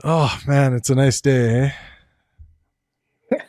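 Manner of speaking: easygoing Canadian accent; mild Canadian lilt with a tag "eh". Shown as a demonstration. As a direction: demonstrate a gentle Canadian accent. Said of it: "like, if I say".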